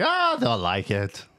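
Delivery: lightly voiced dismissive cook, drawn out; mock cook. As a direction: play a cook shrugging off the complaint, slowly. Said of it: "The cook's back there thinking".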